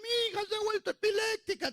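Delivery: hammed-up high wail of a mother; mock maternal panic in a thin high voice; panicked mother. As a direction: wail it way up high, long-drawn "Ay". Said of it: "Y la mamá".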